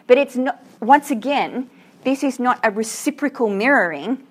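ordinary delivery

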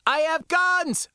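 high, excited shout. A narrator's reading. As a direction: shout the status out to the crew. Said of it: "I shouted".

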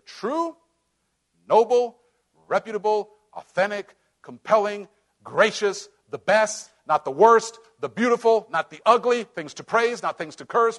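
building preaching list. Acted. Pastor rallying the church through a list, fervent. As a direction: preach each word up to the church.

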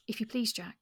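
ordinary talk, nothing marked

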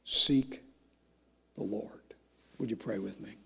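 very soft, low, unhurried invitation; hushed appeal. Real, not acted. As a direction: drop low and soft, pausing between phrases. Hushed appeal turning to prayer.

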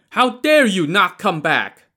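high loud indignant yell; angry shout. A narrator's reading. Pursuers demanding the boatman return, angry shout. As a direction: shout your outrage across the water.

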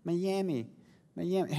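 put-on soft, pitiful little toddler plea; imitated toddler crying. Dad imitating baby girl missing her lamb, soft mock crying. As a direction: mimic a small child pleading tearfully, softly. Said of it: "she's crying and she's like".

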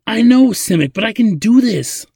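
loud, high, insistent plea; pleading. A narrator's reading. Ladin begs Simic to trust him, pleading. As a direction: plead hard, voice pushed high. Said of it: "Ladin pleaded again".

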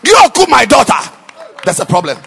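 loud, ringing pulpit delivery; full-force preaching. Acted. Preacher driving home to the congregation, loud and ringing. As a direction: preach it out to the back rows.

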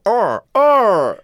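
high loud hero grunts; Ultraman grunts. Retold or imitated. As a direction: shout it like a TV superhero. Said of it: "he'd just go".

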